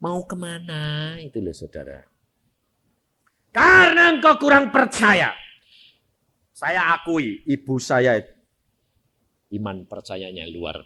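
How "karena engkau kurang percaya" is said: louder, rising rebuke from the pulpit; fervent preaching. Acted. Preacher rebuking the congregation's weak faith, forceful. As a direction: drive the point home hard.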